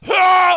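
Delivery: loud, high mock retch; exaggerated throwing-up roar; imitated puking. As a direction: heave out a big comic vomit noise. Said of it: "begins to puke into the purse".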